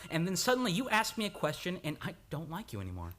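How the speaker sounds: rising complaint, half teasing, half fed up; exasperated outburst. Acted. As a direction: vent your irritation at the smug doctor. Lover's mock complaint at doctor.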